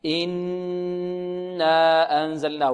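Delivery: very slow "إنا", long hummed noon then stretched alif; chanted "إنا أنزلناه" with sustained nasal hold; ghunnah and madd. Shown as a demonstration. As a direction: hum the nn of "إنا" at length before the long aa. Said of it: "نغن ونمد الألف بمقدار حركتين".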